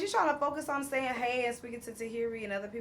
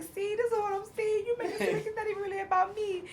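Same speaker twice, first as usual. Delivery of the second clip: laughing quote; 12 semitones higher, faster than usual. Retold or imitated. Erica complaining, quoted laughing.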